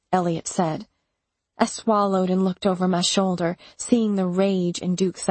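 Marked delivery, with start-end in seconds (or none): none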